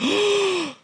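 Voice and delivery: sharp, very high gasp of amazement; a date floored that the door was opened for her; mimicked gasp. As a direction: gasp like she can't believe it. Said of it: "She's like".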